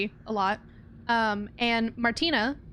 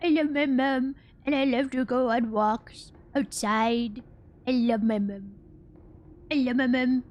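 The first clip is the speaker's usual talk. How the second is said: Spudgy. Retold or imitated. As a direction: slur it like a happy, devoted dog. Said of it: "so it'd be like".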